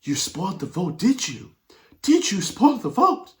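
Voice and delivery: hammed-up incredulous questioning; mock disbelief. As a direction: imitate the presenter's horror, climbing. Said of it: "She said".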